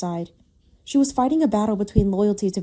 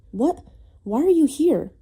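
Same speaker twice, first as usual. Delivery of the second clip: startled question; 6 semitones higher, slower than usual. A narrator's reading. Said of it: "his voice hoarse".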